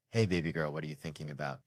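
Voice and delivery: soft tender call to his daughter; tender dad question. Retold or imitated. Dad softly asking his daughter what she's thinking, tender. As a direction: coo it gently like a proud dad. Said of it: "Great moment as a parent to say".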